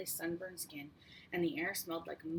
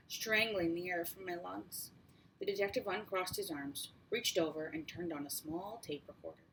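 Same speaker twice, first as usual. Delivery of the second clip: plain reading voice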